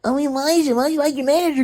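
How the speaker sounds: high-pitched mocking imitation; high, whiny-style mock questioning; mocking nagging relative. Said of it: "they get mad".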